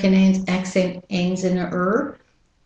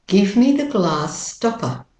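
British-Australian long "ah" in "glass"; no final R in "stopper", British-Australian style; British-Australian model sentence; British-Australian accent. Shown as a demonstration. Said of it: "And with the American accent".

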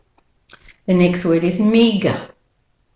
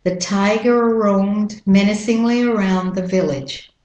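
American "tiger roamed" linked on one R; American-accent model sentence. Shown as a demonstration. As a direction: glide through "tiger roamed", American style. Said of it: "So you link them together".

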